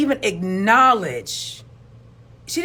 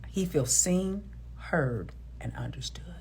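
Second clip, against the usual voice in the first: lower, much softer and slow; soft fading; 5 semitones lower, 10 dB quieter than usual. Real, not acted. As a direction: let the words fade into near silence. Softly listing empathy.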